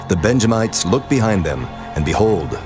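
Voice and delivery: normal talking voice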